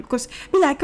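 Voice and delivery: usual speaking voice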